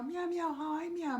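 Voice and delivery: high kitty puppet saying its name; kitten puppet voice. Pretend. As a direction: speak as a tiny kitten puppet.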